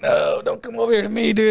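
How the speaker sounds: high, pleading wave-off; dismayed refusal. Retold or imitated. Bystander to the slapped man, dismayed refusal. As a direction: plead in mock dismay to be left alone.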